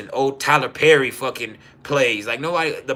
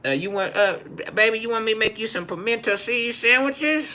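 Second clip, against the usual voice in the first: higher, sweet offer; higher doting nana voice; 8 semitones higher than usual. Retold or imitated. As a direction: coo at him like grandma.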